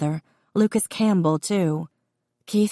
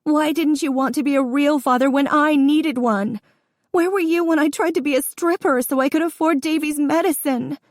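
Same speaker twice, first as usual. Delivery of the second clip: very high, loud crying accusation; sobbing shout; 10 semitones higher, 4 dB louder than usual. Acted. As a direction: wail it through the sobs. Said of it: "The sobs were out now".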